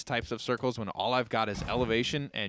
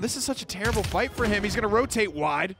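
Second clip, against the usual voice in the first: esports caster; 7 semitones higher, 6 dB louder than usual. Real, not acted. Live play-by-play fight.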